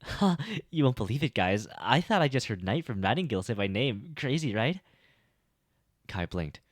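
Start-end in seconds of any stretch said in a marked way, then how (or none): 0.0-4.8 high, loud, bubbly disbelief; giddy disbelief. Acted. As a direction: gush about hearing a famous name, much higher and louder. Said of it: "Cassie giggled".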